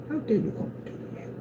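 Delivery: high, hushed mock indignation; mock offence. Vlogger acting insulted by haters, mock offence. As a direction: gasp it softly in pretend shock.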